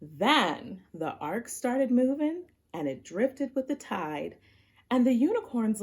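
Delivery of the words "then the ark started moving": ordinary delivery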